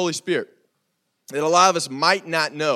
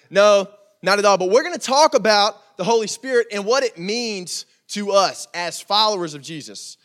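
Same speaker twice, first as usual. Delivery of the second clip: normal talking voice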